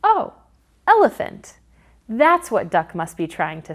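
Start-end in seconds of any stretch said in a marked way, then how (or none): 0.0-1.6 high, delighted oh of discovery; aha, I get it; sudden realisation. Shown as a demonstration. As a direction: act out a bright aha moment. Teacher acting sudden realisation.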